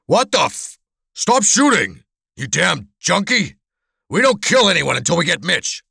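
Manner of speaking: high, exasperated yelling at a partner; angry yell. Acted. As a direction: yell at your trigger-happy accomplice. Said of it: "the hulk yelled at the wiry man".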